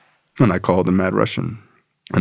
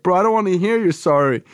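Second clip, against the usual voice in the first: much higher put-on voice of a blunt sponsor; tough sponsor voice; 10 semitones higher than usual. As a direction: bark out the tough-love lecture.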